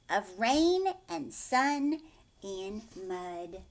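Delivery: put-on gentle storybook reading to children; bedtime-story narrator; story narration. A narrator's reading. Reading picture book aloud.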